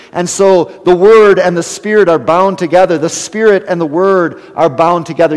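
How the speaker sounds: loud, rising sermon climax; full-force preaching. Acted. Preacher urging the congregation on Spirit and Word, full force. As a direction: press the point home to the congregation.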